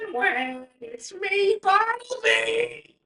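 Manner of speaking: very high, slow nasal-free squeal of a name; nemesis voice. Acted. Nemesis Bartleby announces himself to Branwen, very high. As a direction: announce yourself in a high shrill sing-song. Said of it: "he kinda turns and goes".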